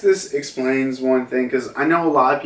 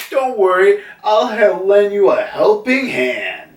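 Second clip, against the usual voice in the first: higher, louder, confident put-on voice; reassuring helper; 8 semitones higher, 4 dB louder than usual. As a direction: offer help in a higher, confident voice. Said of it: "it's like".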